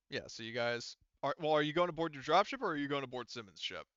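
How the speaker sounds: normal talking voice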